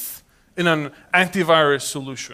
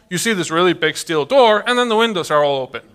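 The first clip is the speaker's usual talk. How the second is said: ordinary delivery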